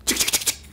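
rapid tongue-clicked shutter sound; chik-chik camera noise; imitated camera clicks. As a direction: mimic a traffic camera snapping. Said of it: "עזוב שוטר, מצלמה".

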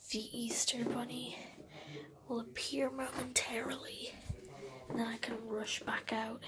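hushed whisper so the parents won't hear; sneaky whisper. Real, not acted. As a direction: whisper as if creeping through the house. Hiding, waiting for the Easter Bunny.

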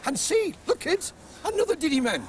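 high, bouncy music-hall compère; comic showman voice. Acted. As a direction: greet the kids like a jolly stage comic. Comic host greets the Diddy Men.

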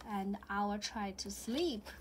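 ordinary delivery, nothing marked

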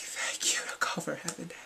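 hushed grateful whisper. Retold or imitated. Fan whispering thanks for the cover. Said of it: "I was like".

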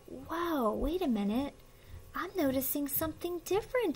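soft, high-pitched amazement with gasps; hushed wonder. Pretend. Reader asks child to spot the changes, in hushed wonder. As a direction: marvel with a small child over a picture.

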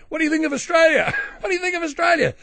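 high, fast, loud mock reporter; pestering press reporter. Press mobbing a foreigner just off the plane, shouted high and fast. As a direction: shout it rapidly in a shrieky high voice. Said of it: "the first thing the press would do is say".

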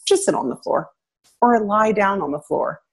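normal talking voice

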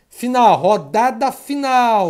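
slow, stretched-out announcement; announcing final round. Pretend. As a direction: draw it out for suspense. Anuncia a última rodada. (anúncio arrastado de rodada final)

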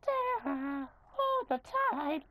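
very high, cracking squeal; squeaky cracked falsetto. Pretend. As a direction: let the voice break way up high. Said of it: "that voice crack".

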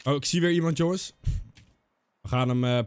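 normal talking voice

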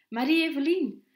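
high, loud shout of a name; calling out. A narrator's reading. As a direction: call her name over the crowd. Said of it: "iemand mijn naam riep".

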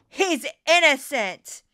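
loud, slow proclamation; emphatic declaration. Real, not acted. Summing up expert opinion for reporters, emphatic declaration. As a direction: land it hard and drawn out.

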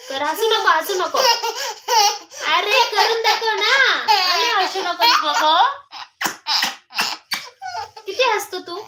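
mock-stern while giggling; laughing scold. Real, not acted. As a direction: tell him off through your own laughter.

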